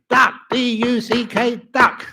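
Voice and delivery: punchy duck spelled out; with oomph. Shown as a demonstration. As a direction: wake the audience up with it. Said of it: "give it some oomph".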